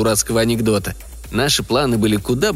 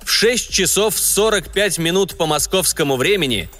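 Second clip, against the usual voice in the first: raised, slow, solemn radio announcement; booming solemn state announcer; 4 semitones higher than usual. Acted. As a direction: proclaim it like Soviet state radio. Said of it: "Говорит и показывает Москва".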